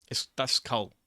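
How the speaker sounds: normal talking voice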